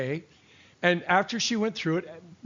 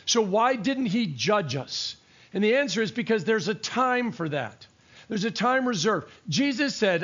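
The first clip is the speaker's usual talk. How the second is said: preaching; 4 semitones higher than usual. Acted. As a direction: drive the point home from the pulpit. Preaching at rising force.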